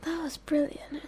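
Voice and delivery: whispered. Real, not acted. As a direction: breathe it out in awe. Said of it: "whispers praise after the clip".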